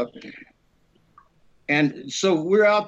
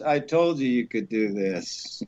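lightly voiced father; 6 semitones lower than usual.

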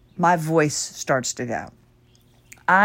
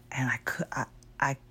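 whispery failing voice, much softer; voice giving out; 5 semitones lower, 11 dB quieter, faster than usual. Real, not acted. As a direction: let the voice drop out. Voice failing while recounting.

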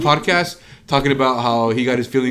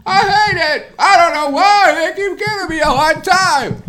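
much higher, louder whiny Seinfeld; terrible Seinfeld impression; 9 dB louder than usual. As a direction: do a bad Seinfeld whine. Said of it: "terrible impression of Seinfeld".

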